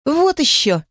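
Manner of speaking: high scornful snort. Acted. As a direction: toss it off with contempt. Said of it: "фыркнула Мита".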